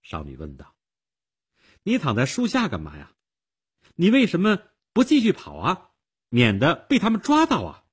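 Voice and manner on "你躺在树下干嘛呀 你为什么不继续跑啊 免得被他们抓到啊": put-on high, loud young girl's questioning; insistent little girl; inquisitive girl. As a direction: press them with pointed questions in a girlish voice. Voices the girl questioning the fugitive.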